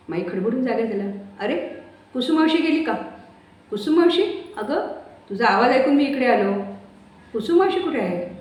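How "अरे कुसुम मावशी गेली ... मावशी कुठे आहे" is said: much higher, raised voice asking for Kusum; startled questions in character. Acted. Just-woken Mai asks for Kusum, high and urgent. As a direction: wake confused, searching for her.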